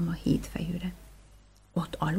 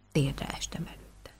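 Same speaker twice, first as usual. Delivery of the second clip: plain reading voice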